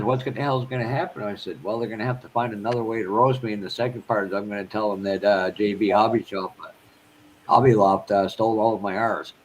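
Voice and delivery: normal talking voice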